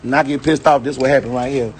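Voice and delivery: usual speaking voice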